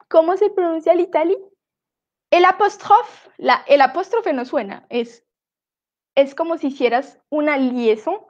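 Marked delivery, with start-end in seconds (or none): none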